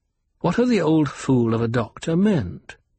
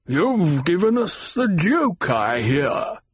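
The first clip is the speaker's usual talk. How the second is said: Baron voice; 6 semitones higher, slower than usual. A narrator's reading.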